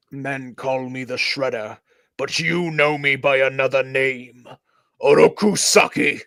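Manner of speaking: lightly voiced menacing villain; a hint of a sinister mixed voice. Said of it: "trying to mix a couple of voices together".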